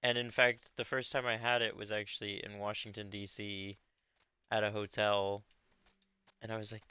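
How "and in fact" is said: ordinary talk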